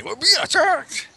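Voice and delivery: high squeaky attempt at the orc; imitating orc voice. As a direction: try the orc yell and miss. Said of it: "I can't even do it".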